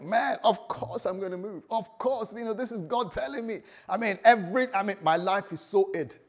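high, loud, eager rush of certainty; excited agreement. Acted. Listener told by God to move, excited and eager. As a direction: act out someone thrilled to say yes. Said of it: "So Abraham was excited".

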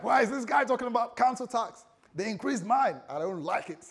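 high, loud mock outrage; indignant complaint. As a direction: act out people griping loudly. Acts out people complaining about tax.

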